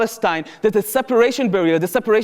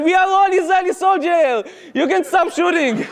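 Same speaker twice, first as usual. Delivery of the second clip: mock crowd shout; 9 semitones higher than usual. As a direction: shout it back together, half laughing. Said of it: "a bunch of Palestinians are coming and said".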